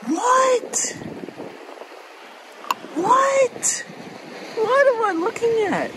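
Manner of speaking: repeated high squeals of disbelief; real overjoyed shrieks, voice jumping way up; shrieking disbelief. Real, not acted. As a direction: squeal as if you can't believe your eyes. Fifth shell found, overwhelmed.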